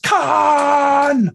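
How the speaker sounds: huge high shouted "Khan!"; a mock Shatner scream of rage; Kirk's Khan yell. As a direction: bellow the name like Kirk. Famous movie yell imitated.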